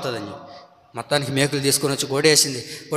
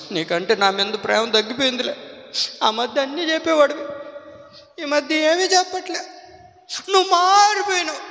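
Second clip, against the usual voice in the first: whining wife; 11 semitones higher than usual. Retold or imitated. Wife voiced complaining husband changed.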